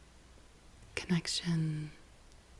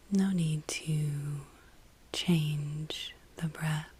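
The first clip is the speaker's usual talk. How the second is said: guided meditation; 3 dB louder than usual. Real, not acted. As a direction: ease the listener into the breath, unhurried, with long pauses.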